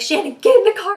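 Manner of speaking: loud, high panicked call; imitating friend screaming. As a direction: scream for her to get back in. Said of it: "My friend at this point is screaming".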